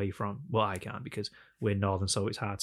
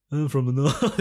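mock-dim Northerner, raised and louder; hammed-up dopey Northern voice; dull Northerner; 4 semitones higher, 6 dB louder, slower than usual. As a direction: send up your own Northern accent as slow-witted. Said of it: "you assume we're a bit dull".

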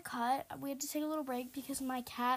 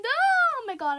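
high, loud burst of alarm; panicked scream; 12 semitones higher, 13 dB louder, slower than usual. Real, not acted. Gamer already being chased, panicked scream. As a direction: shriek it as the chase starts.

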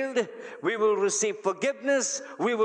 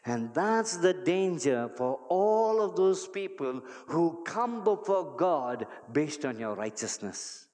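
normal talking voice

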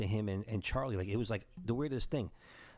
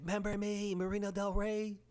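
calling out; 10 semitones higher than usual. Retold or imitated. Fan calling to drummer at concert.

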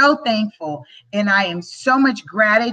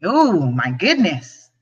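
choked up; 4 semitones lower, slower than usual. Real, not acted. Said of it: "not gonna get emotional".

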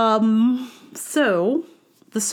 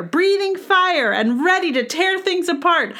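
mock fierce boast; 9 semitones higher than usual. Mock dragon boast, laughing.

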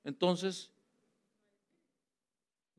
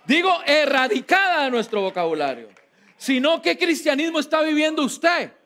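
louder, laughing, hammering the point home; emphatic shout; 5 semitones higher, 4 dB louder than usual. Real, not acted. Preacher insists, repeating the point.

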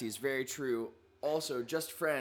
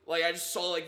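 ordinary talk

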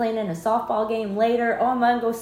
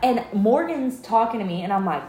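ordinary delivery

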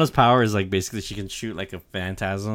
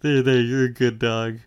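mock soothing pet talk; 4 semitones higher, 5 dB louder than usual. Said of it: "He's, like, petting him".

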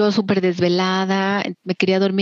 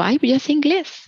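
imitando su propia confianza ingenua, más aguda; "ya sé inglés" dicho con aire ingenuo de suficiencia; self-confident quip; 8 semitones higher than usual. Retold or imitated. (burla ligera de sí misma, confiada)

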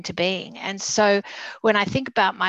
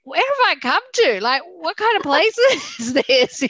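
retold shock, pitched up high, half laughing; bewildered thought; 8 semitones higher, 5 dB louder than usual. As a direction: replay the stunned kid in you, with a laugh. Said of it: "all I remember thinking was".

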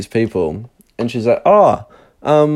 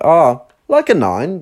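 sarcastic nonchalance; 7 semitones higher, slower than usual. Retold or imitated. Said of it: "sarcastically imitate her nonchalance".

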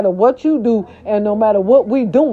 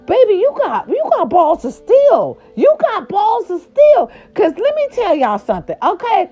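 excited praise; 11 semitones higher than usual. Real, not acted.

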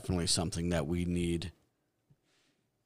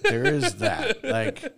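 laughing; 5 dB louder, slower than usual. Real, not acted. Laughing at co-host's joke.

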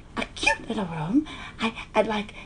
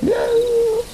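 1 calling out; 9 semitones higher, 4 dB louder than usual. Acted. Calling out into the dark.

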